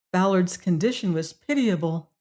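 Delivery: usual speaking voice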